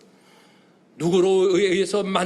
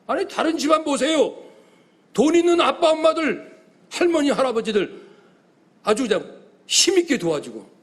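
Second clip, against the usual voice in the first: higher, louder indignant protest; indignant complaint; 6 semitones higher, 4 dB louder than usual. Acted. Young man protesting to God about rich families.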